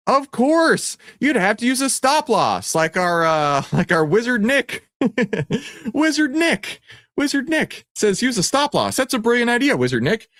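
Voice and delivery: high sing-song teasing; playful high voice. Pretend. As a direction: hype the wizard comically. Mock wizard praise, very high.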